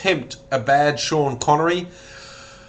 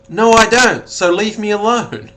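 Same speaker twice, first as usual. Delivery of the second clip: louder and higher, telling the heckler off; a raised, retold rebuke; mock angry outburst; 5 semitones higher, 6 dB louder than usual. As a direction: snap back at a rude crowd with mock indignation. Retelling [name] scolding hecklers.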